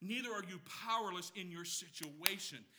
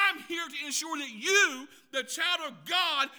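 emphatic promise; 7 semitones higher, 11 dB louder than usual. Acted. Spirit's promise, voiced by preacher.

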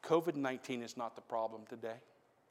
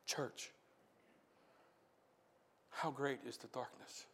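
hushed preaching; 11 dB quieter than usual. Real, not acted. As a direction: draw them in almost under the breath. Quiet reflective sermon passage.

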